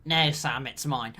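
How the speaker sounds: low, soft mock-possessive refusal; hushed, dropped-pitch character quote; mock Frodo. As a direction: imitate a hero quietly claiming his prize. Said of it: "And then he's like".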